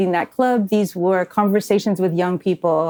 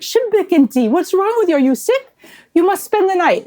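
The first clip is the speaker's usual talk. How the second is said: higher, louder, pressing a guest to stay; insistent Syrian host; 11 semitones higher, 3 dB louder than usual. Retold or imitated. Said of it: "They go".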